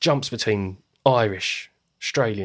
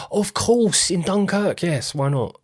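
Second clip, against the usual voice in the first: much higher, gushing casting agent; mock delight; 7 semitones higher, slower than usual. Casting agent gushing over [name], hammed-up excitement. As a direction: gush over the idea like a starstruck agent.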